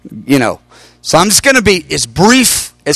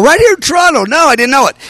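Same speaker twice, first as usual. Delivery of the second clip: surprised; 10 semitones higher than usual. Retold or imitated. Surprised reply to news.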